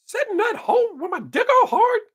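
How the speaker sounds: very high squeaky cartoon mouse singing; Mickey Mouse voice. Singing the song to viewers in a high Mickey Mouse voice. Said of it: "in Mickey Mouse's voice".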